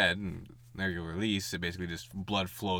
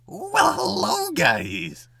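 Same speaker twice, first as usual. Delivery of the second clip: much higher, slow, louder squeaky greeting; put-on funny voice; 8 dB louder, much slower than usual. Shown as a demonstration. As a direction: stretch the hello way up high. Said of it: "I don't need to go".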